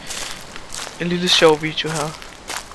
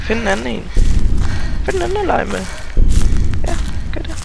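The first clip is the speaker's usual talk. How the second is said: high pleading; 7 semitones higher, faster than usual. Real, not acted. Telling Slender to go away.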